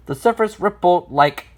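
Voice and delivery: ordinary delivery, nothing marked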